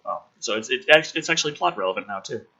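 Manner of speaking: ordinary talk, nothing marked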